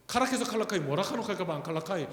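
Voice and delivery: rapid-fire Busan dialect patter. Shown as a demonstration. Preacher quizzes congregation on 카라, in Busan dialect. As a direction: rattle it off in Gyeongsang dialect. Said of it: "이게 부산 사투리입니다".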